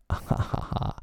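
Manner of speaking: soft breathy gloating laugh; villain's laugh. A narrator's reading. Hook laughing over his evil plan. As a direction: chuckle with wicked delight. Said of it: "He burst into laughter".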